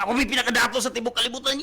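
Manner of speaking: quick, high boast of being the richest; lightly voiced bragging, high and hurried; boastful quote. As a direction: crow it fast like a proud rich man. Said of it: "kung musulti na ako na".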